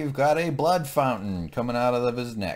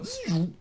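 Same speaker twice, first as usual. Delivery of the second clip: mock power-down zoom; 13 semitones higher than usual. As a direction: make a machine noise. Shutdown sound effect.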